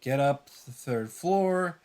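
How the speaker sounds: ordinary talk, nothing marked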